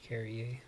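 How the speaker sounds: "Carrier" ending in -ay, the r silent; French-style pronunciation. Shown as a demonstration. Gamer settling on the player's likely French name, "Carrier" ending in -ay. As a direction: say "Carrier" as car-ee-AY. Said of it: "he's probably French".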